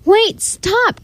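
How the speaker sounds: crying out. A narrator's reading. Baker cries out as the dog leaps, high alarmed call. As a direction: cry out high to halt someone. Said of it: "cried the baker".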